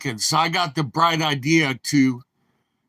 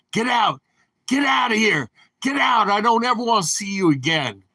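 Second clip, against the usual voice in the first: furious shout; 6 semitones higher, faster than usual. Retold or imitated. Officer with soiled pants yelling at the trucker to get out, furious. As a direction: pitch climbing higher and higher into an angry yell.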